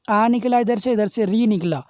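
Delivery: ordinary talk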